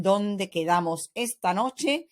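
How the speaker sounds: rising pitch at the end on "noche"; question intonation. Shown as a demonstration. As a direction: let "noche" climb like a question. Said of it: "my voice goes up at the end of the question".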